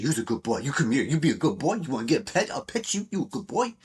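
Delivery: high, eager voice for a dog; excited dog talk. Shown as a demonstration. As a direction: hype up a good boy. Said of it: "you gotta give direct energy".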